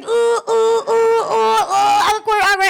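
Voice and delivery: high, loud squeamish oohs; high squeamish oohs of discomfort; awkward squeals. Retold or imitated. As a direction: squirm out loud. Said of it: "So I'm just like".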